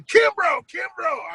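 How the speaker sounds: high calling "Kimbrough, Kimbrough"; calling out name. Retold or imitated. Fan calling to his friend in right field. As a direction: call his name across the stadium. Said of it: "I'm yelling".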